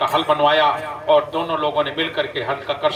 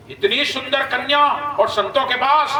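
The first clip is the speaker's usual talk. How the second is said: astonished; 7 semitones higher than usual. Acted. Ravana astonished at seeing the girl.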